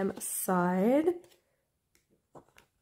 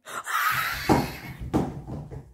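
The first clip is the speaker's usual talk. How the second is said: huge airy high gasp; stifled scream. Real, not acted. Fan reacting to the reveal, stifled scream. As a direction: scream held in, only air. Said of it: "trying not to scream out loud".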